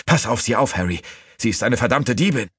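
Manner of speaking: high, urgent warning; angry order. A narrator's reading. Sneaton handing the thief to Harry, high angry order.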